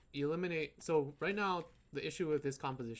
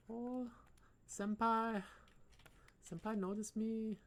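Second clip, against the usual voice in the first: high, slow gushing fangirl voice; excited anime fan; 8 semitones higher, slower than usual. Pretend. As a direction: swoon over how cute it is. Swooning anime fangirl line.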